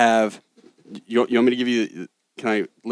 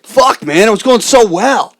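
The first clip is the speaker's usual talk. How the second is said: mock frustrated outburst; 11 semitones higher, 12 dB louder, slower than usual. Real, not acted. Reacts to being derailed.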